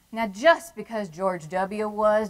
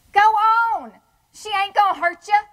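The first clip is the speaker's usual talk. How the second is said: bossy command; 10 semitones higher, 7 dB louder than usual. Acted. Ordering the robber kid.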